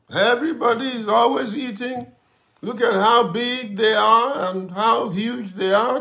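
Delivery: high, loud whiny complaint; mock grumbling. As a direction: whine like a sulky child at the fast. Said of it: "I'm just grumbling".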